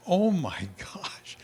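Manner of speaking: slow breathy whisper with a laugh; hushed laughing oh my gosh; awed whisper. Acted. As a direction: whisper it in amazement. Awed reaction to remodeled building.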